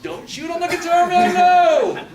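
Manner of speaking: high, very loud pretend yell, half laughing; panicked yell. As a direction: shriek it up high as if guns are aimed at you. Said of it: "I'm gonna yell out".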